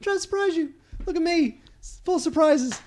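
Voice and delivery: flustered excuse. Retold or imitated. Retelling his flustered excuse to his date.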